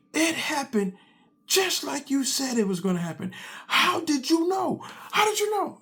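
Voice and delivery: put-on high, breathless boy, rising in amazement; excited kid. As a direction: marvel that dad was right. Excited son retelling the fight.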